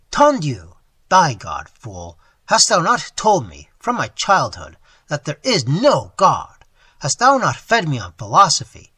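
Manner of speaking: loud, scornful tirade; bitter tirade. Acted. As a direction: sneer and rage at the old man.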